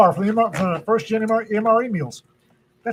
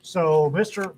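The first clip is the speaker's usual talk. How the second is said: ordinary talk, nothing marked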